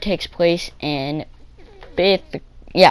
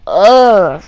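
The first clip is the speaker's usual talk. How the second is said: disgusted ugh; 2 semitones higher, 8 dB louder than usual. Retold or imitated. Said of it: "It's like".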